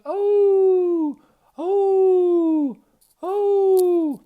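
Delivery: long high loud howl; high loud imitation dog howl; dog howling impression. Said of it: "That's my best howling impersonation".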